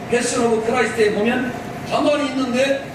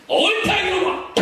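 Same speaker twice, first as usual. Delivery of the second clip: high, drawn-out mock outburst; hammed-up scolding, pitched way up; indignant retort; 9 semitones higher, slower than usual. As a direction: cry it out in acted indignation. Acting an indignant retort to mockers.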